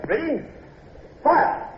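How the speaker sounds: high, commanding fire order; shouted order. Acted. Officer calling the gun crew to fire.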